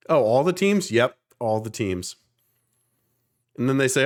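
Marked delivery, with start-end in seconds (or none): 0.1-0.9 high, eager "all the teams?"; mock surprised questioner, pitched up. Mimicking people quizzing him. 0.9-2.2 slow, flat "Yep, all the teams"; calm confirming drawl; matter-of-fact. Retold or imitated. His own retold reply.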